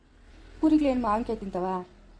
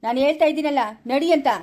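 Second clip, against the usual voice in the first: louder, higher, commanding yell; angry shout; 4 semitones higher, 7 dB louder than usual. Acted. Son shouting at his mother to go home. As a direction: bark the order to go. Man orders mother to leave.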